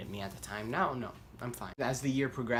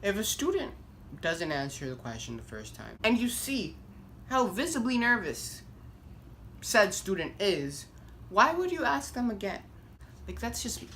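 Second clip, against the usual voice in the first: indignant rant; 8 semitones higher than usual. Real, not acted. Ranting about the teacher.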